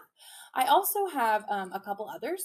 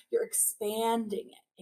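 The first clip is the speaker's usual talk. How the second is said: usual speaking voice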